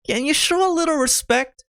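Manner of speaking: high-pitched, affronted demand; indignant scolding. Acted. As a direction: scold the musician for disrespect. Scolds organist at mother's funeral.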